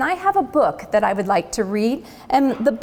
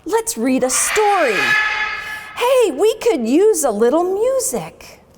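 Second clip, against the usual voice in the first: perky Sparky; 6 semitones higher than usual. Acted. Perky Sparky voiced in story.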